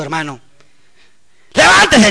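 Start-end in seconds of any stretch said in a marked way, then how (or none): 1.5-2.1 high-pitched retold rebuke; authoritative command. Preacher retelling how he confronted a bully, commanding rebuke. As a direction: command it with borrowed divine authority. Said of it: "con toda la autoridad de Jesucristo".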